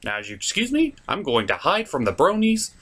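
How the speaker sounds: usual speaking voice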